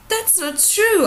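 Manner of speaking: put-on a girl loudly denying the queen's charge. A narrator's reading. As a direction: protest hard. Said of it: "Ira said loudly".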